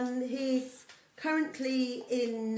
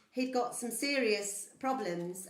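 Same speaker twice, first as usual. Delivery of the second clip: ordinary talk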